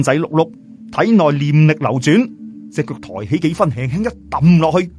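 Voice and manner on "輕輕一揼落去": usual speaking voice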